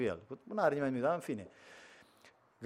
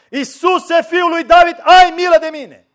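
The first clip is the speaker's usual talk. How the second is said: desperate cry, much louder and higher; 19 dB louder, slower than usual. Retold or imitated. Blind Bartimeu calling over the scolding crowd, desperate loud cry. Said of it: "să strige mai tare".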